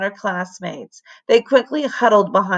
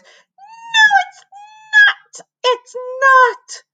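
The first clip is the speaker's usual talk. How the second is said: high furious shriek; yelling; 5 dB louder, much slower than usual. A narrator's reading. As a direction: scream the denial. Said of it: "she yelled".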